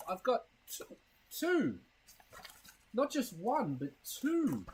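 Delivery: usual speaking voice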